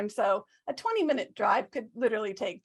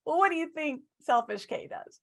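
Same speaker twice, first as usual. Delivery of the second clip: ordinary talk, nothing marked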